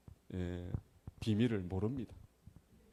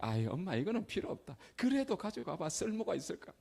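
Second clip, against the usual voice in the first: mother and son exchange; 8 semitones higher than usual. Retold or imitated. Mother and son dialogue retold.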